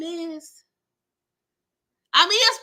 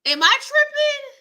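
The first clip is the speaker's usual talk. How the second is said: much higher, louder, squealing disbelief; squeaky disbelief; 5 dB louder than usual. Real, not acted. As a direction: shriek it like you can't believe it. Disbelief repeated twice.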